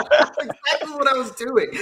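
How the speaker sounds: laughing, high and bright as he owns up; sheepish laugh. Real, not acted. To Robert, caught loving the room, laughing as he admits it. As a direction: admit it through a real laugh.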